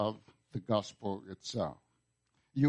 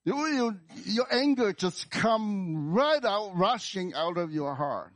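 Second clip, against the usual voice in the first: high, loud preaching easing at the end; impassioned outburst; 10 semitones higher, 4 dB louder than usual. Shown as a demonstration. Preacher showing listeners anger bursting from the heart, impassioned cry. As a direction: let it rush out high, then come down.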